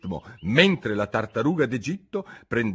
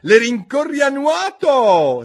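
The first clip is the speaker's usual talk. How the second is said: high, loud, drawn-out yell; shrieked; 10 dB louder, slower than usual. A narrator's reading. Gryphon shrieks the next step, high loud yell. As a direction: shriek the command, stretching it out. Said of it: "strillò il grifone".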